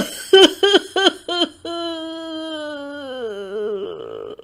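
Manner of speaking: bitter weeping of a father; sobbing. Acted. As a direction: break down weeping uncontrollably. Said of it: "फूट फूट कर रोना शुरू कर देते हैं".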